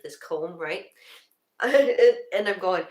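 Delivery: usual speaking voice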